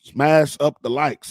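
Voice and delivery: pushy hype-man call with "smash" hit hard. Retold or imitated. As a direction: hype the crowd, punching "smash". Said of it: "like [name] would say".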